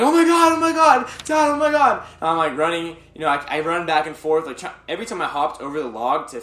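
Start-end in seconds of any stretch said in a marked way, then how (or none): 0.0-2.0 high, loud frantic cries for Dad; panicked yelling. Retold or imitated. Lost child yelling to dad across a fallen log, panicked. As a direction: call out desperately, high and loud.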